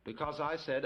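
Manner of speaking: ordinary delivery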